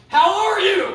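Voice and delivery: high, loud yell; yelling at deaf dad. Retold or imitated. Son yelling a greeting at his deaf dad, high and loud. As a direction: shout it so a deaf dad hears. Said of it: "I just have to yell at him".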